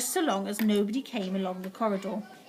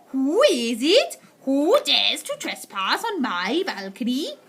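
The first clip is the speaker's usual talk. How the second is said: slow, louder witch voice; Grand High Witch demanding; 3 semitones higher, 6 dB louder, slower than usual. A narrator's reading. Witch demanding who trespasses, slow and menacing. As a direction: draw each word out menacingly.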